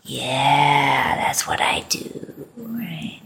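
breathy teasing whisper, voice dropping right away; playful whisper. Acted. Teasing his jealous partner with a playful whisper. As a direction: whisper it like a naughty secret.

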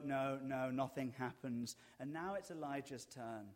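ordinary delivery

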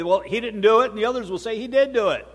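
usual speaking voice

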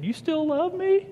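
put-on high, slow, timid child's question; small child asking. A caught four-year-old asking her dad, high and timid. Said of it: "And she said".

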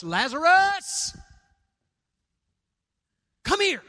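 booming, high summons across a distance; loud commanding call. Acted. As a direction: call a man out of the tomb at full voice. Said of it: "he spoke in a loud voice".

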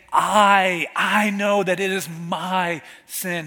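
"I" hit loud and held, then repeated; emphasis on I. Acted. David owns his sin before God, heavy stress on "I". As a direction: punch the word "I" as if in bold capitals. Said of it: "there is this emphasis on the word".

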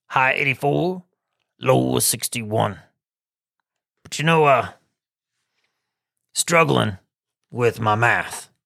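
lightly voiced goofy weatherman; a hint of a dopey weather guy; Rick weather voice. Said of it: "we've got Weather with Rick".

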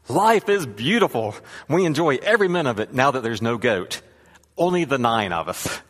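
radiant exclaiming. Acted. Man returns to rabbi without goat, radiant exclaiming. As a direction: exclaim it with overflowing relief. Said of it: "radiant, exclaiming".

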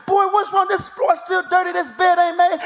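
high-pitched retold parental tirade; worked-up mock scolding, held high; lion-dad scolding. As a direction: act out bursting in on the boy, pitch way up. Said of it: "stomping up the steps like the lion".

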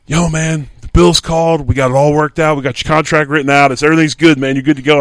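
high and loud, hyped-up buddy on the phone; excited roommate. Retold or imitated. Roommate phoning that the Bills called, loud high excitement. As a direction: imitate a thrilled friend blurting out good news. Roommate calling with contract news.

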